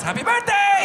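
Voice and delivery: very high, loud cheerful cry; cheery mom. Retold or imitated. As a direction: sing out a gleeful greeting. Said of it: "She goes".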